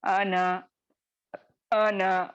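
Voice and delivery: अन said as a clipped an with no long a after the n; short an sound. Shown as a demonstration. Teacher shows students how to read the word without the alif. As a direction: read अन as a short an, not अना. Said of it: "जैसा मैं बताई".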